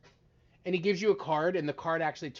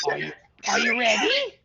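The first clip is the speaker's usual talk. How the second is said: card magician patter; 6 semitones higher, 6 dB louder than usual. Acted. Wizard teasing before trick.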